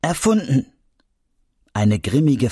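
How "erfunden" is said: firm. A narrator's reading. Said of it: "unterbrach sie sehr bestimmt".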